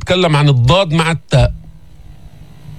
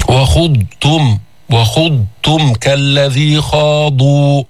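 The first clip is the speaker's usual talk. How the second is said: slow chant with the ض in وخضتم held; tajweed chant; Quran recitation; much slower than usual. Shown as a demonstration. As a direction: recite slowly.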